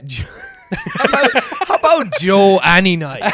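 laughing while speaking; cracking up, voice jumping up. Real, not acted. Laughing about Joe.

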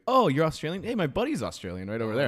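loud, high retold exclamation; mock eager greeting. Host pointing out his buddy to an Australian, mock excitement. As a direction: imitate a delighted host calling out.

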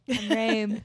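teasing Philly vowel in RAME; mock Philly accent. Co-host echoing the host's name, mock Philly accent. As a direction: say Rame with a Philly-style long A. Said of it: "We do, like, a Philly accent".

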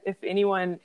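ordinary delivery

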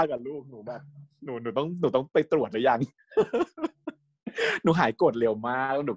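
put-on baby-cute voice through giggles; cute girly voice. Recapping the scene in a cute voice, giggling. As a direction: keep the sweet babyish put-on going. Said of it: "ก็ทำแบบเสียงแบ๊วขึ้นมาอีกแล้ว".

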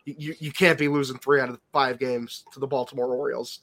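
normal talking voice

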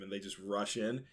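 normal talking voice

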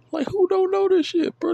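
very high, loud squeal of disbelief. Real, not acted. Mocking obvious trivia, a squealing high who don't know. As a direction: shriek it, can't believe it. Outraged rhetorical question.

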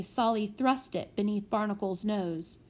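ordinary delivery

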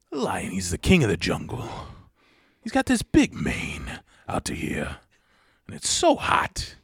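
quiet, threatening monologue with long pauses; Christopher Walken. Acted. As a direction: menace softly in halting, oddly broken phrases. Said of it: "when Walken gets quiet and threatening".

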